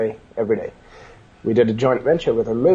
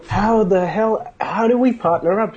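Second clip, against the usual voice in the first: eager partner; 9 semitones higher, slower than usual. Retold or imitated. Business partner asks eagerly, retold.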